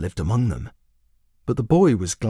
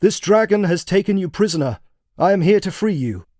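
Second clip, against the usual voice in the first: calling knight; 8 semitones higher than usual. A narrator's reading. Knight calling to the boy, high heroic call. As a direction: announce it like a rescuer. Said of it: "called to the boy".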